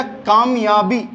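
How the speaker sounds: कामयाबी said slowly, syllable by syllable; कामयाबी sounded out slowly for a pupil; slow careful reading. Shown as a demonstration. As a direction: spell out कामयाबी slowly for a child. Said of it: "अब बोलना है कामयाबी".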